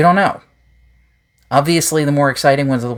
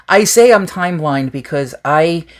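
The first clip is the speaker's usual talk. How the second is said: normal talking voice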